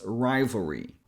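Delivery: RIVALRY stressed on RAI, middle A nearly skipped; first-syllable stress. Shown as a demonstration. Teacher putting the parts together, "rivalry" stressed on the first syllable. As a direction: say "rivalry" with a tiny middle and a tense EE ending. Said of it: "this is the syllable we emphasize".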